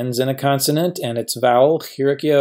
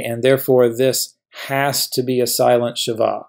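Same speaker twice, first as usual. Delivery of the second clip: ordinary talk, nothing marked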